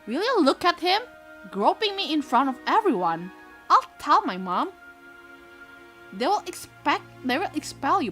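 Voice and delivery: put-on high, loud, indignant girl; accusing schoolgirl voice. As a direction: whine it like a tattling girl. Acting girl accusing loudly.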